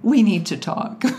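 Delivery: lightly weighty quote of dreaded words; mock-dreaded announcement. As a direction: announce it with playful gravity. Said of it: "the dreaded words for a man".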